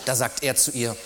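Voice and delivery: usual speaking voice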